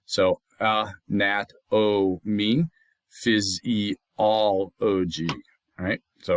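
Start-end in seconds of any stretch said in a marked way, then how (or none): 0.6-2.7 "uh, nat, o, me" with a gap after each syllable; teacher breaking "nat, o, me" into separate sounds. Shown as a demonstration. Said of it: "This is an O and that's an E". 3.3-5.3 "phys, e, all, o, g" as separate beats; teacher spacing out each vowel in "phys, e, all, o, g"; syllable by syllable. Shown as a demonstration. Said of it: "This is an O and that's an E".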